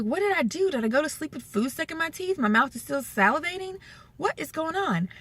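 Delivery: high, puzzled rush of questions; baffled alarm. Retold or imitated. Woman waking with a watery mouth, high baffled alarm. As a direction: sound startled and confused on waking.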